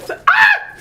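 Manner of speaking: sudden loud high yelp; startled yelp. Real, not acted. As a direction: cry out as the cat jumps up. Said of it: "rude cat interruption".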